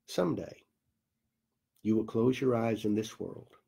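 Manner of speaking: ordinary delivery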